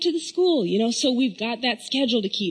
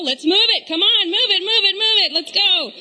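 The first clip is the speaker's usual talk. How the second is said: louder, higher rallying move it; hurrying mom; 6 semitones higher, 3 dB louder than usual. Retold or imitated. Mom hurrying her daughter out of bed at dawn. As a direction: chivvy her along like a coach.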